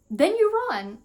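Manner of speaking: high, loud command; acted urgent mother. Mother telling daughter when to flee, acted urgency. Mom's urgent instruction, read in character.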